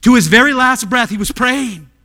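high, loud pulpit emphasis; forceful proclamation. Real, not acted. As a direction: drive it home in one unbroken burst. Forceful repeat of the hushed statement.

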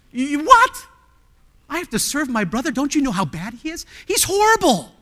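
mock a child complaining about his brother; lightly voiced indignant kid; whiny kid voice. Pastor voices a kid objecting.

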